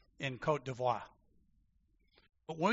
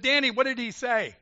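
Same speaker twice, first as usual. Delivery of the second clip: louder, higher pressing question; insistent demand; 8 semitones higher, 8 dB louder than usual. Retold or imitated. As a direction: demand an answer from the interpreter. Insisting to interpreter.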